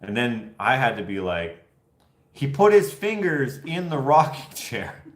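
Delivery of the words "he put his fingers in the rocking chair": laughing while quoting. Retold or imitated. Retelling his own line, laughing.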